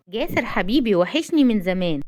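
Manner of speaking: plain reading voice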